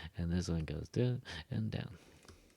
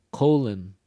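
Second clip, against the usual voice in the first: "Colon" with "co" punched and louder; stress on first syllable; 8 dB louder than usual. Shown as a demonstration. Teacher repeating the pair so students hear "Colon" stressed at the front. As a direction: hit the "co" in "Colon" hard. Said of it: "Listen again".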